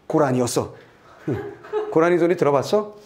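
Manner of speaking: ordinary talk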